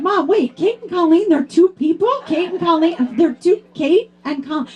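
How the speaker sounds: put-on high, puzzled child asking Mom a question; little daughter voice. Daughter asks Mom if Kate and Colleen are two, childlike. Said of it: "She said".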